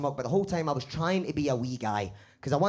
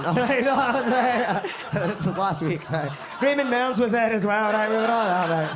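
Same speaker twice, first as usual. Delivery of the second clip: laughing; 8 semitones higher, 6 dB louder than usual. Real, not acted. Laughing at heckle banter.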